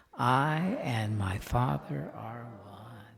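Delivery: very slow, drawn-out affirmation; slow, hushed, reverent recitation. Shown as a demonstration. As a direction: let each word sink in slowly. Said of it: "Let's say that".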